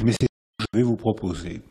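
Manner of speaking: ordinary talk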